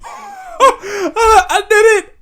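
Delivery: high, loud laughing triumph; overjoyed. Real, not acted. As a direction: laugh out the victory. Celebrating his grades, laughing.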